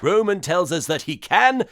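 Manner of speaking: ordinary talk, nothing marked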